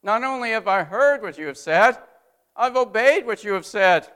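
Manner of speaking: loud, high call-back; wheelsman's reply. Retold or imitated. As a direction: report the order done, firm and clear. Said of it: "he says".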